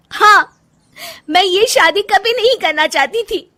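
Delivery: very high, loud confession; upset wife close to breaking; anguished outburst. Acted. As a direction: blurt out the long-held truth. Wife's outburst about marriage.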